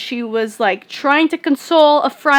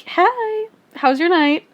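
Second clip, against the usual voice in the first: high, sing-song casual hello; light, high-pitched chirpy text voice; mock friendly greeting; 6 semitones higher, slower than usual. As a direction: imitate an oblivious friend checking in.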